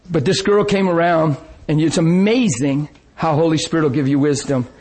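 ordinary talk, nothing marked

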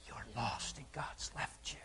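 whispered, hissing taunt; unvoiced whisper of the devil; devil's whisper. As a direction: breathe it like a tempter in her ear. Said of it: "the devil came and said".

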